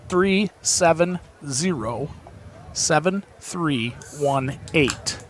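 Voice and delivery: very slow, word by word; slow number readout. Real, not acted. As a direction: read each digit out slowly and separately. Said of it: "I'll say it slow".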